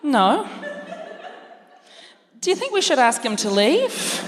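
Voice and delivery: a hint of a worried mum. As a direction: Mum asks Dad whether the man should leave, lightly voiced. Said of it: "That's my character work".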